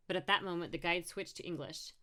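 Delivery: ordinary delivery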